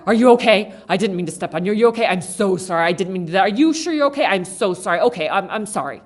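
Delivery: loud, gushing apology to a stranger; over-the-top polite sorry to a stranger at Walmart; effusive apology. Retold or imitated. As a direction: fuss over a stranger's stepped-on foot. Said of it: "I say things like".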